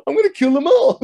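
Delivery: high, loud cartoon goof with chuckles; Goofy. Playing off an insult with a silly laugh, goofy impression. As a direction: put on a daft, high cartoon voice. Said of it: "like Goofy".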